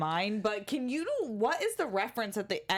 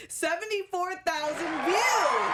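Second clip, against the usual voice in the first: slow, loud, high reveal; excited reveal; 7 semitones higher, 3 dB louder, slower than usual. Real, not acted. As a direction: stretch out the big number. Big number reveal.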